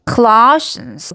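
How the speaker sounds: Colossians with a long, strong LOSS; stress on second syllable. Shown as a demonstration. Teacher repeating for learners, Colossians stressed on LOSS. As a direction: slowly lean on LOSS in Colossians. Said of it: "러의 강세가 있어요".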